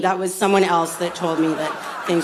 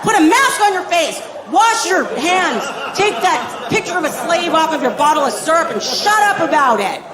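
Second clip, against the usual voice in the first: dad shouting threats; 7 semitones higher than usual. Acted. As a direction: turn this car around yelling. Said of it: "Dale's like".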